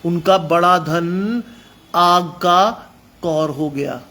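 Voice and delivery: slow and heavy, every word drawn out; slow dramatic preaching. Acted. Preacher to congregation about the fire, slow and heavy. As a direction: lay out the ruin slowly, word by word.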